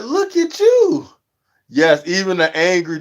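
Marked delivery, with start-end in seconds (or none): 0.0-1.1 "Look at you." very high, slow and drawn out, mocking; exaggerated roast. Vlogger roasting a scowling streamer, "Look at you." very high and drawn out. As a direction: stretch "Look at you." up high in mock disbelief. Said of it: "I'm like".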